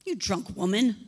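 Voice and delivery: a hint of a harsh accuser; accusing priest. Eli accuses Hannah of drunkenness, lightly voiced scorn. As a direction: spit out the accusation. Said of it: "accuses her of being drunk".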